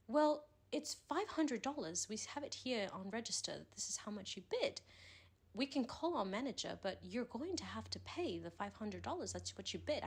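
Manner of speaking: insistent put-on English accent. Fake eBay caller demanding five hundred dollars, English accent. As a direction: press him for payment in mock English. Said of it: "do the best English accent".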